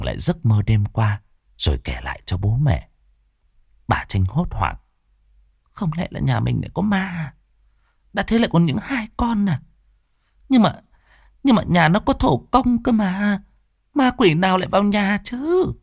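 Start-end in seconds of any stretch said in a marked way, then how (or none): 5.7-15.7 high, alarmed mother; panicked mother. Acted. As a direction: fret in disbelief, voice shooting up. Mother panics about ghosts. (người mẹ hốt hoảng, giọng cao vút)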